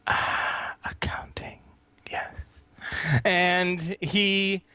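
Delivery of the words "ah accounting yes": whispered reverent echo; voiceless, awed whisper; whispered awe. Acted. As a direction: breathe the word out in a whisper. Cultist echoes the word in awe.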